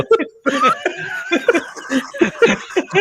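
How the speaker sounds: long, high peals of laughter; high-pitched laughing fit. Real, not acted. Host cracking up at a co-host's antics, high laughter.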